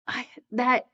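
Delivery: usual speaking voice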